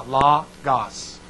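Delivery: logos said lah-gahs with short O sounds; correct logos. Shown as a demonstration. Teacher gives the correct spelling's sound, lah-gahs. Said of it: "It's spelled this way".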